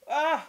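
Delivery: sudden loud, high "ah"; pained cry. Real, not acted. Cook stung by onion fumes, pained cry. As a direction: cry out at the sting. Onion stinging her eyes.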